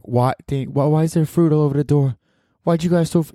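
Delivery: high-pitched New York complaint; New York accent. Retold or imitated. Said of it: "he was, like, from New York".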